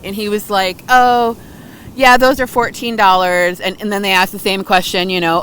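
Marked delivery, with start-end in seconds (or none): 0.9-3.6 higher, louder, extra friendly clerk; super sweet. Retold or imitated. Clerk answering the nice couple, sugary sweet. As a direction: sell it sweetly, all smiles. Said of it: "the guy was super sweet".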